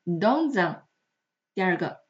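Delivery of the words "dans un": careful "dans un" with s said as z; voiced liaison. Shown as a demonstration. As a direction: join "dans un" through a z. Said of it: "这个s啊是要被浊化掉的". (dans un连读，s读成z)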